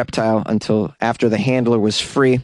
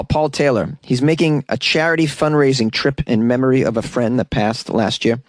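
ordinary delivery

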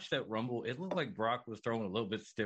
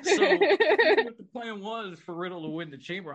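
laughing, louder and slower; 9 semitones higher, 9 dB louder than usual. Real, not acted. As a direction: talk through the laughter. Laughing while talking.

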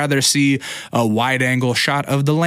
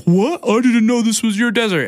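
snooty lady; 10 semitones higher than usual. Retold or imitated. Rude lady answers back.

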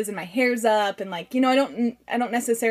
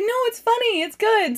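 high-pitched imitation of reassurance; gushing sweet fan; 13 semitones higher, 5 dB louder than usual. Super sweet parent praising her video, high and gushing.

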